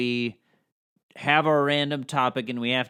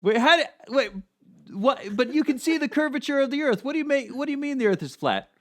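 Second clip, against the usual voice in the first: exasperated arguer; 12 semitones higher than usual. Pretend. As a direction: sputter at a flat-earther. Arguing voice of a debater.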